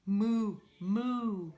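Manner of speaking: loud mooing; cow moo. Shown as a demonstration. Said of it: "You follow Miss Debbie".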